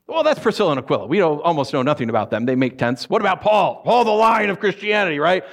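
ordinary talk

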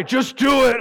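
slow, loud, commanding shout from above; booming angel voice. Acted. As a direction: boom it down like a heavenly messenger.

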